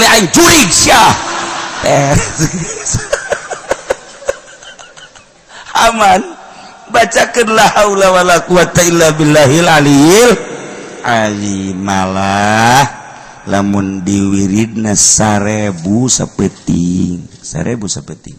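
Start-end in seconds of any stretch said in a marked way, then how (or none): none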